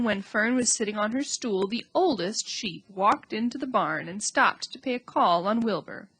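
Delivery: ordinary delivery, nothing marked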